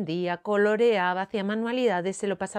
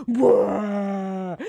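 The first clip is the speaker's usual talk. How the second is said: long held bellow; mock bawl; 4 dB louder than usual. A narrator's reading. Storyteller as raging Moncho, bawling. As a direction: bawl it out like a child in tantrum.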